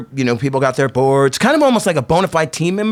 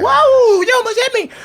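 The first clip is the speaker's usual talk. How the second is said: put-on a kid's high startled yelp, louder at first; 4 dB louder than usual. Kid nearly landed on, high startled cry. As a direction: squeak it out like a spooked kid.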